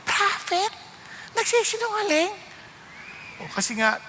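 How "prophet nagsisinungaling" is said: mock disbelief. Preacher questions Abraham's prophethood.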